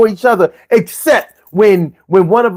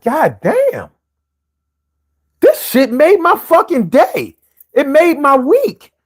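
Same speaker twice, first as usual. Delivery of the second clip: higher, stunned gratitude; tickled disbelief at being pampered; amazed delight; 5 semitones higher, slower than usual. Retold or imitated. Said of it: "and you realize, like".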